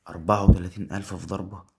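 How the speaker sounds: loud astonished question; amazed. Real, not acted. Streamer reading the payout aloud, loud disbelief. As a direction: shout the number in disbelief.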